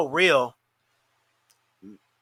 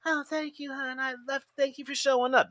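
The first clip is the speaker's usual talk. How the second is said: put-on higher, syrupy girly thanks; mocking a streamer thanking a donor, higher; mock sweet streamer; 7 semitones higher, 6 dB quieter than usual. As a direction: gush it like a streamer to a tipper.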